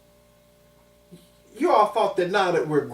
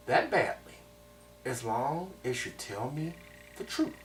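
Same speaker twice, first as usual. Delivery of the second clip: quiet threat, words dropping away; menacing teacher; 2 semitones lower, 3 dB quieter than usual. Retold or imitated. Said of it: "these are the words that he said to me".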